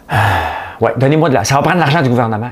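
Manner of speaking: lightly voiced mock politician pleading; mock mayor voice. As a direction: parody a politician begging for cash. Mocking the mayor's demand for money.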